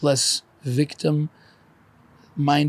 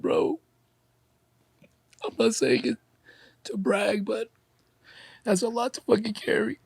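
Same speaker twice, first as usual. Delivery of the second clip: choked up, pitch pushed high; real crying; 6 semitones higher than usual. Real, not acted. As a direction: fight back tears while talking, voice tight. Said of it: "I think that's why I'm crying".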